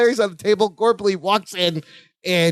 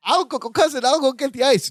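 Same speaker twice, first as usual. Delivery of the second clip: higher-pitched Balky imitation; eager helper; 5 semitones higher than usual. Balky offering his cousin ice, high eager voice. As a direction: pitch it up, keen to help.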